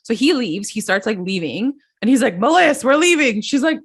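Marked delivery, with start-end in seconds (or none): 2.4-3.4 raised, louder imitation of calling out; higher-pitched mock yell; mock angry call. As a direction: call out to your wife as if storming off. Acting Joe storming out to wife.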